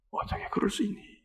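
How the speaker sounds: whispered reproach. Acted. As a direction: say it as a secret thought. Inner voice, whispered.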